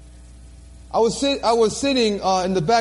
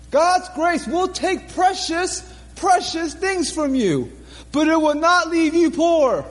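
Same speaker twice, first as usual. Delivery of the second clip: fervent preaching; 3 semitones higher than usual. Acted. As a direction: preach it home to the congregation. Preaching the closing message.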